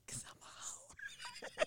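breathy, slow secret whisper; joking whisper to the manager; whispered joke. Retold or imitated. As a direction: whisper it like a naughty confession. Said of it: "And I was kinda like".

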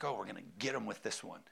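low, quick sneaky aside; sly mock scheming. Pastor mocks a scheming author, low and quick. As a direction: mutter it like a plotting preacher. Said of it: "writes that to get people. Like".

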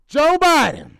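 loud call to the president, dropping each time. Acted. Calling out Joe Biden for a clip, loud. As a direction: shout his name, then ease off.

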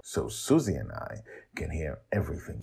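ordinary delivery

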